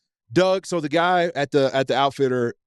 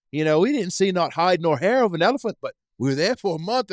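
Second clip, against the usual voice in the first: put-on a light New Zealand accent, raised and bright-toned; Kiwi accent; 5 semitones higher than usual. Telling a dinner story of hunting dwarf elephants, in a New Zealand accent. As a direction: mimic a well-traveled Kiwi hunter, New Zealand accent. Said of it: "he got to talk in his Kiwi accent".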